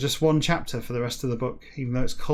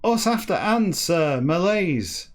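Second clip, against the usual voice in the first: higher, drawn-out read-aloud; story narration; 7 semitones higher, 6 dB louder, much slower than usual. Acted. Reader reciting a line of the novel, declaimed narration. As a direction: declaim it slowly, raised in pitch.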